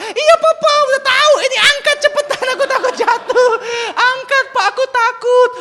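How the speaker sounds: put-on very high, loud little-boy wail; pleading child. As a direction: beg dad in a hammed-up toddler voice. Son begs to be lifted.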